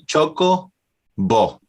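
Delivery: "Choco bo" in two beats, "Choco" high, "bo" dropping after a pause; toddler pronunciation. Retold or imitated. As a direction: say "Choco bo" like a small child, chopped in two. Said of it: "She said".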